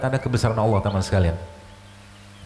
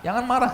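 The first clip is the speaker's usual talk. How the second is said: nada tinggi, tegas pada kata "marah"; menirukan pesan Nabi; 11 semitones higher than usual. Retold or imitated. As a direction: ucapkan "Jangan marah" seperti nasihat yang ditekankan. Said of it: "kata Nabi". (mengutip larangan marah dengan tegas)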